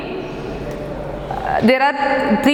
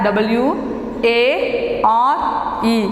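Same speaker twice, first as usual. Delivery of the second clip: letters spelled out slowly one by one; slow spelling; much slower than usual. Shown as a demonstration.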